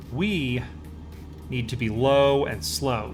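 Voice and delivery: ordinary talk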